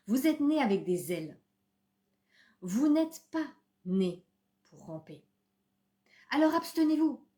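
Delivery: declaimed poem with deliberate pauses; poem recitation. Acted. Reading a beloved Rumi poem to viewers, emphatic recitation. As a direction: recite it line by line, with emphatic words.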